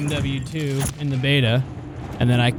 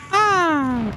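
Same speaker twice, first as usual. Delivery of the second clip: startled yelp; 13 semitones higher than usual. Real, not acted. Startled during gameplay.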